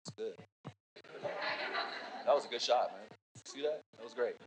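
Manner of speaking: quietly satisfied teammate; calm fan. Retold or imitated. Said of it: "that's gonna go".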